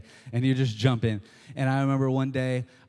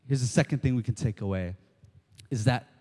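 normal talking voice

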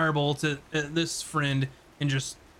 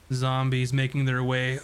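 ordinary talk, nothing marked

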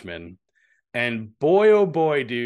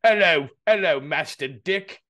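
hammed-up British accent, pitched up then slowing; imitating a stiff British butler, high and loud; mock British butler; 5 semitones higher, 5 dB louder than usual. Mocking the actor's British accent as Alfred. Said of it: "he's shoving the inflection back down".

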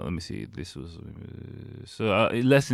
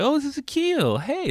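much higher mock housewife voice introducing him; imitating the eager lady. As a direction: introduce the guest in a high bright mock lady voice. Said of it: "He's like".

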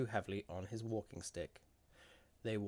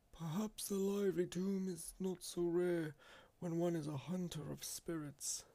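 put-on high-pitched old seer's voice; musing old man; 9 semitones higher than usual. A narrator's reading. Old man replying to the spirit hunter, musing.

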